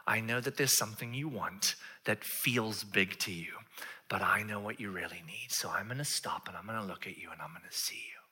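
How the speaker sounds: soft, low, half-whispered promise; hushed, sincere comfort; gentle reassurance. Acted. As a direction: speak quietly and tenderly to them. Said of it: "and he will say".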